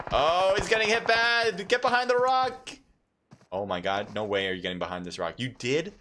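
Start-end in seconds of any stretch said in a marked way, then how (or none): 0.1-2.7 high, urgent caster cry; excited shout. Real, not acted. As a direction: yell it as the fight turns. Player under heavy fire.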